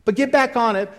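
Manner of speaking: loud, high, fervent preaching; rousing pulpit preaching. Acted. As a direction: preach it out with full force to the crowd. Preaching at full force.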